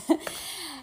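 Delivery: short, high excited giggle. Real, not acted. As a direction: giggle over a secret. Teasing a surprise.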